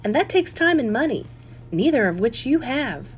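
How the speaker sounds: lightly voiced, doubting mother; mother's voice. A narrator's reading.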